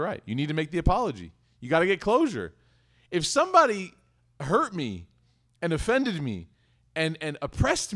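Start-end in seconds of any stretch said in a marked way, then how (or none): none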